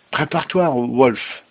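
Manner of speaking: ordinary delivery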